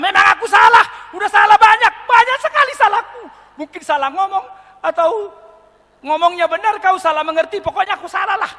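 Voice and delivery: high, loud comic confession; frantic apology. Retold or imitated. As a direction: take all the blame in mock panic. Acts husband frantically admitting all blame.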